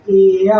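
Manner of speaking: a drawn out एय glide; teacher models एय; vowel demo. Shown as a demonstration. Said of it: "तो हम इस ए को पढ़ते हैं".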